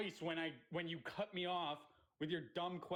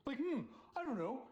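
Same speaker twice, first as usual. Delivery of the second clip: sarcastic; 6 semitones higher than usual. Real, not acted. Sarcastic answer to chatter.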